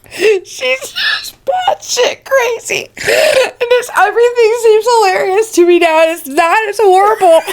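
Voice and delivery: high squeaky words through laughter; talking while cracking up; laughing hard. Real, not acted. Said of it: "I can't talk".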